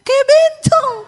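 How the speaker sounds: very loud, high yell; retold horrified shout. Husband reacting to wife's long hair, horrified high shout. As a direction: shriek it out like an appalled husband. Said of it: "dia teriak".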